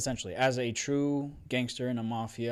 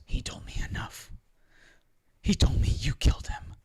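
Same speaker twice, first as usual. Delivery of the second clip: put-on breathy, strained young hero; Luke Skywalker; 2 semitones higher, 4 dB louder than usual. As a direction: answer back in an anguished half-whisper. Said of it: "And then Luke says".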